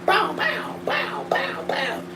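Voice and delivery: high bow bow shots fading to whisper; shoot, then let it die away; gunshot noises fading. Pretend.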